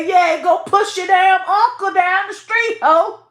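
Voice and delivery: high, loud taunting jab at her uncle; mocking a rude girl. Retelling how the New York girl mocked her uncle, mock taunting. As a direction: throw the insult back as the girl said it. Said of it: "One time that bitch said".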